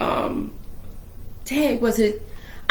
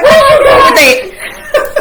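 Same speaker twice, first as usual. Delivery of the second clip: high, loud shocked shout; shocked yelling; 10 dB louder than usual. Retold or imitated. Sister yelling at her brother over the gun, shocked and loud. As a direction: yell at him in disbelief, half laughing.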